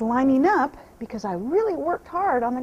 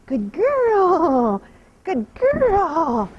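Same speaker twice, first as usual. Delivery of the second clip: high, louder praise for the horse; loud happy praise; 6 semitones higher, 7 dB louder, much slower than usual. Real, not acted. Trainer rewarding her mare, loud and high. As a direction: call out praise warmly and high.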